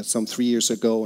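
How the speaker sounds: usual speaking voice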